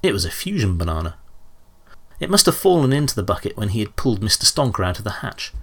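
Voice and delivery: ordinary delivery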